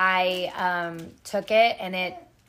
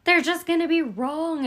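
retold anxious whine; 9 semitones higher, 5 dB louder than usual. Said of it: "such a negative Nancy".

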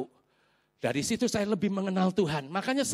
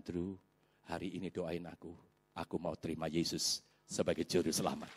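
low, hushed retold plea; subdued, solemn surrender; humble plea; 13 semitones lower, 11 dB quieter than usual. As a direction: ask for prayer softly and humbly. Man kneels and asks for prayer.

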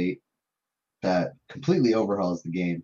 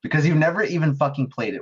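angry; 7 semitones higher, 6 dB louder than usual. Real, not acted. Angry rant at friend.

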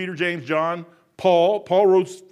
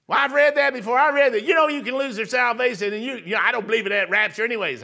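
imitating mom; 4 semitones higher than usual. Son mimicking his ninety-year-old mom, higher and dismissive. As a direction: toss it off like a stubborn mother. Mom voiced in retold argument.

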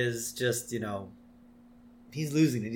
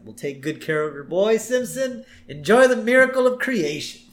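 Ned Flanders voice; 9 semitones higher, 6 dB louder than usual. Retold or imitated. Ned voiced in retold scene.

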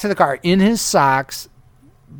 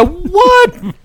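very high, stunned disbelief; a squeaked-out, astonished what; shocked what; 4 dB louder than usual. Retold or imitated. The driver retelling his own stunned reaction to the fart, very high.

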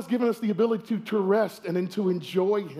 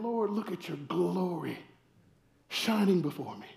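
reverent praise; 3 semitones lower, 7 dB quieter than usual. Retold or imitated. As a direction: marvel quietly at his glory. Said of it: "As opposed to saying".

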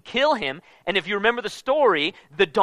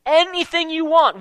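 eager offer; 8 semitones higher, 4 dB louder than usual. Retold or imitated. Rich envoys pleading.

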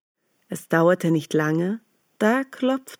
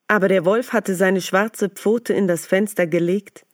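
plain reading voice

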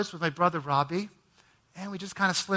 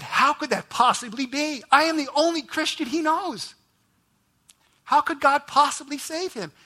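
stunned disbelief; 9 semitones higher, 4 dB louder than usual. Retold or imitated. Stunned at brother's salvation.

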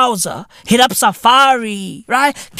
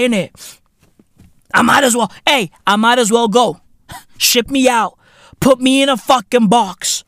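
ordinary talk

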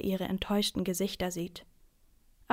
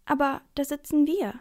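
high, louder brave objection; plucking up courage; 7 semitones higher, 6 dB louder than usual. A narrator's reading. Girl claiming her seats from the man, bravely. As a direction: object bravely, pitch lifted. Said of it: "nimmt Charlotte ihren ganzen Mut zusammen".

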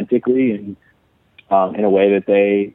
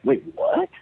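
much higher, startled squeak; shocked disbelief; 4 dB quieter than usual. Retold or imitated. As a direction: caught totally off guard by the news. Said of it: "we all were a little like".

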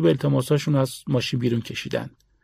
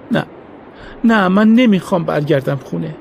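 pleading; 4 semitones higher, 4 dB louder than usual. A narrator's reading. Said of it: "ملتمسانه گفت".

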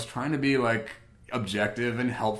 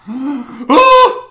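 surprised gasp; 18 dB louder than usual. Retold or imitated.

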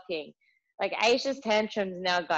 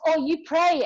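raised high, a little louder, curious educator; educator's reply; 9 semitones higher, 5 dB louder than usual. Retold or imitated. Childcare educator asks the mum about praying, imitated surprise. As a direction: lightly mimic the educator's surprised question.

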